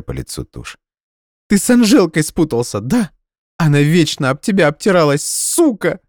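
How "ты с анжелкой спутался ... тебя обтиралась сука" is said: high, loud angry voice; jealous accusation. Acted. Jealous girlfriend accusing him of cheating, high loud anger.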